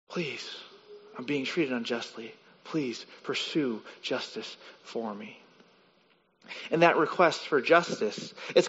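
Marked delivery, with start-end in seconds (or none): 0.1-5.4 quiet plea. Pretend. Psalmist pleading with God.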